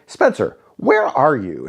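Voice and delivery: high, sharp questioning boss voice; boss's suspicious demand. Retold or imitated. Boss on the phone demands where he is, suspicious high voice. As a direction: mimic the boss pressing for the truth. Boss catching him lying.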